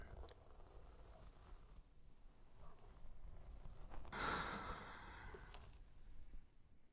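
long whispered sigh; drawn-out sigh. Acted. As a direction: breathe out slowly and audibly. Sigh during whispered hypnosis.

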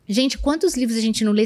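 normal talking voice

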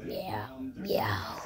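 low, whispered meows; cat meow. Pretend. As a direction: let the meows sink to a soft whisper. Playing at meowing like a cat.

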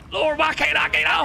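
high, loud desperate cry to the heavens; panicked plea. Real, not acted. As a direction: beg the boat to let you on. Stuck in water, can't board boat.

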